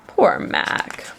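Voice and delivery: usual speaking voice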